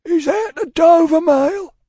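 slow, high, loud holler; calling from afar. A narrator's reading. Rider in the mist hailing the coach, long holler. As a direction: call through mist to someone distant. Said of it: "a man's voice called from the mist".

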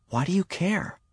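put-on high, soft girlish retort; challenging young girl. A narrator's reading. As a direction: challenge him in a small high voice, hushed. Girl challenges her worried brother.

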